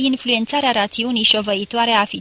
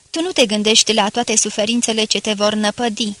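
ordinary delivery, nothing marked

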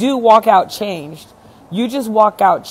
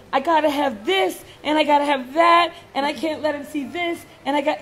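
higher-pitched mock urgency; mimicking needy people; 6 semitones higher, 4 dB quieter, faster than usual. Coach imitating people who cling to their armor, mock-needy. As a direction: list needs in a raised, imitating voice.

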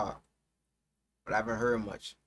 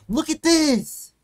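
loud, high, drawn-out exasperated shout; angry yell; 11 semitones higher, 8 dB louder, much slower than usual. Real, not acted. Streamer showing viewers what annoys them, angry yell. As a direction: yell it slowly in outrage. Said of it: "I'm getting mad".

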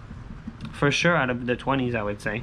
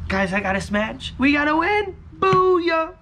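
shrieking jackpot winner; 14 semitones higher, 3 dB louder, slower than usual. Real, not acted. Second win shout.

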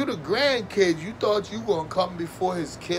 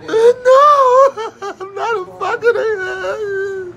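laugh-crying; 10 dB louder, much slower than usual. Real, not acted. Breaks into laugh-crying mid-line.

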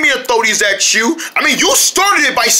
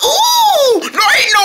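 delighted coo; 3 dB louder, slower than usual. Acted. Cooing at chameleon.

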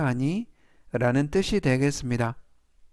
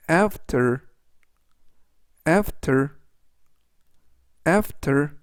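careful, spaced-out vocabulary drill; slow model reading; much slower than usual. Shown as a demonstration. Teacher reviewing words for learners to repeat, slow careful reading. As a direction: read it three times, leaving room to repeat. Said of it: "제가 천천히 세 번씩 읽어드리고요".

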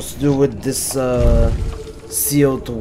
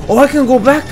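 surprised; 11 semitones higher, 7 dB louder, faster than usual. Real, not acted. Surprised he can go back.

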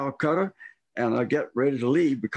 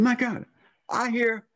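startled surprise; 7 semitones higher than usual. Retold or imitated. Suddenly woken by a noise.

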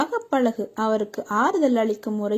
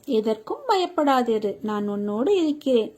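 normal talking voice

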